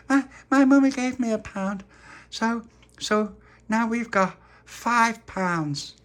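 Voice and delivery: put-on high, loud, stammering child announcement; excited little girl. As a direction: announce it proudly, stretching the ending. Charlotte returns with her pound.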